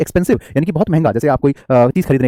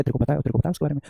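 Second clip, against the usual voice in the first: much softer, like leaning to an ear; lightly acted secret gossip; gossipy whisper demo; 8 dB quieter, faster than usual. Shown as a demonstration. Teacher showing whispering in a friend's ear, softly. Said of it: "कान में बैठ करके कुछ बोल रहे हैं".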